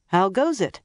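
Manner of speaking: calling across the car. A narrator's reading. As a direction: call it out the window. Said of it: "called out".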